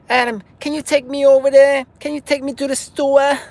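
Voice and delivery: high, loud mock-wife whine; imitated wife. Wife asking husband for a ride, high-pitched imitation. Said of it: "she says".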